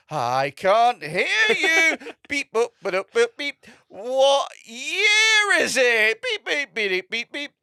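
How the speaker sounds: high, slow, loud robot drone with beeps; robot character. Retold or imitated. Robot act calling to the crowd, high mock-robot voice. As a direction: imitate a robot calling out in long held notes. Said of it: "Comes on stage as a robot".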